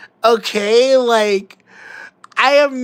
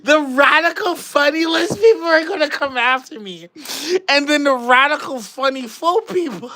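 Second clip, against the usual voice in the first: laughing; 6 semitones higher than usual. Real, not acted. Giggling through the words.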